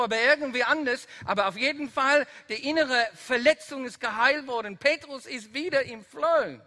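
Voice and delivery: loud, driving sermon climax; full-force preaching. Acted. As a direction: preach it to the back row. Predigt mit voller Kraft. (laute, mitreißende Predigt)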